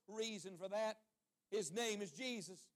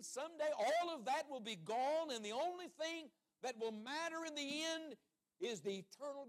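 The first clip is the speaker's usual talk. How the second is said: slow, high-pitched preaching cry; impassioned preaching; 5 semitones higher, 3 dB louder than usual. Real, not acted. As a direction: drive each phrase home like a revival preacher. Preaches at full force.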